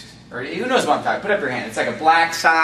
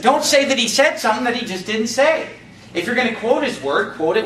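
higher-pitched, insistent pulpit warning; full-force preaching; 5 semitones higher than usual. Real, not acted. Preacher warning against misquoting God, insistent exhortation. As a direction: press the warning on the congregation. Exhortation continues after an Amen.

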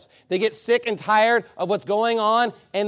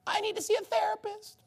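much higher mocking voice; mock needy whine; 14 semitones higher, 7 dB quieter than usual. Preacher mimics a man asking for therapy, much higher mock voice. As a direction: squeak it out like a needy complainer.

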